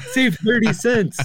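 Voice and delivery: very high, squeaky celebration; mock elation. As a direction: crow over a tiny saving. Acting out joy at saving pennies.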